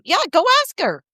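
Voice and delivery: high, bright go-ahead voice; imitating a parent nudging a child forward; encouraging parent. Parent urging child to ask, high cheerful encouragement.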